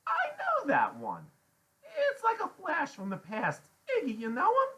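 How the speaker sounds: very high, loud woman character; Loretta's voice. A narrator's reading. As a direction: exclaim high as the memory stirs.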